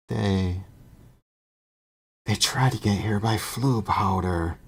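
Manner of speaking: usual speaking voice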